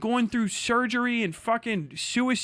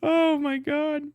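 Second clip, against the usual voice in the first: mock gasp; 8 semitones higher, much slower than usual. Mocking the critic's gasp.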